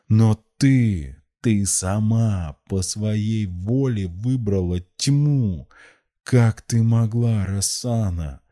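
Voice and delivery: put-on slow, anguished old man; pained reproach. A narrator's reading. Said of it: "с болью выдохнул старец".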